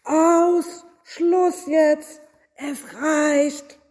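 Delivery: high, angry outburst; furious shouting. A narrator's reading. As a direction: yell to stop the racket. Innkeeper woken by singing, fed up.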